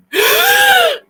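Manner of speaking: loud, high, sharp gasp; big mock gasp. Cinema audience reacts as Jack tells Rose to hold her breath, mock gasp. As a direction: imitate a whole audience gasping. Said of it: "hold her breath, and you literally all go".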